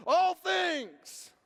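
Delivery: high, loud congregational shout; whole-church response. Retold or imitated. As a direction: answer back like a fired-up crowd. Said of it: "everybody in the whole church went".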